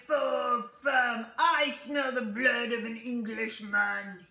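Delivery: loud booming giant chant; the giant sniffing for Jack; giant's voice. Acted. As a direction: boom each word like a giant.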